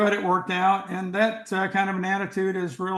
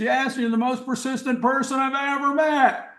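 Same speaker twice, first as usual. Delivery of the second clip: higher, amazed praise, a bit over the top; excited exclamation; 7 semitones higher than usual. Retold or imitated. Chairman marveling at the sculptor who waited five days, excited and higher. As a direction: point right at him and marvel. Chairman pointing finger in face.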